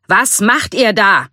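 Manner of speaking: shouting. A narrator's reading. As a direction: shout it word by word, pitch up. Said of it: "schrie Shifu".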